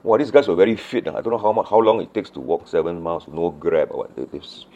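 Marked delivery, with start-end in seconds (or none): none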